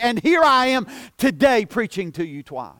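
usual speaking voice

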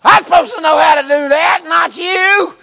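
shouting it high and loud at her; a preacher hollering his point, half laughing; mock shout. Preacher retells scolding a young woman.

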